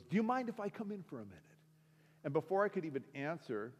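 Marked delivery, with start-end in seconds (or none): 0.0-1.4 high, rapid request; Pastor Dave. Retold or imitated. Pastor asking to come in, high and quick. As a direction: imitate a pushy little preacher, pitched up and quick.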